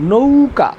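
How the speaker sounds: "নৌকা" as a drawn-out nou, then ka; lengthened diphthong. Shown as a demonstration. Teacher showing it goes like this, "নৌকা" with a long nou. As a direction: hold the ou of "নৌকা" before a crisp ka. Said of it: "উচ্চারণটা হবে এরকম".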